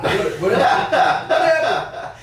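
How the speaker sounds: high, slow, laughing disbelief; laughing surprise. Real, not acted. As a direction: burst out laughing in amazement.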